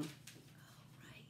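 breathy whispered oh. Real, not acted. Teacher remembering the jam, whispering oh. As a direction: let it slip as a soft whisper.

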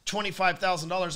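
usual speaking voice

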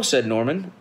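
ordinary delivery

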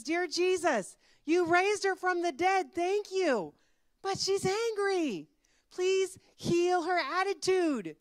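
high, loud plea, slowing at the end; desperate prayer. Acted. Treed man prays about angry buffalo, desperately. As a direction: beg for help at full pitch. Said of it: "he had another prayer".

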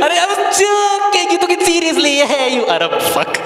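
high-pitched protest. Acted. Advice giver saying it was a joke, high-pitched protest. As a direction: protest it high, in comic disbelief.

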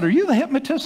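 nervous question. Retold or imitated.

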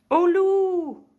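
high, very loud drawn-out yell; cri d'alarme. A narrator's reading. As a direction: cry wolf at full voice. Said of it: "il criait". (cri aigu et très fort)

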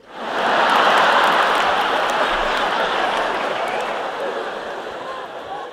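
drawn-out loud burp; long burp sound. Pretend. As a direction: belch it out long. Fake burp joke.

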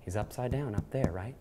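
ordinary delivery

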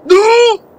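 very high, loud scream of NO; scared scream. Real, not acted. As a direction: shriek it in sudden fright. Scare in horror game. (grito agudo de susto)

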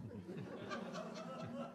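airy devil's cackle; wheezy laugh. Acted. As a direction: cackle under your breath.

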